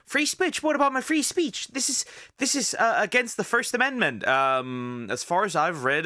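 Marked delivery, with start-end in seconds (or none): 0.1-4.3 high-pitched mock indignation; mock protesters. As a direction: imitate people complaining, pitched well up. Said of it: "people be like".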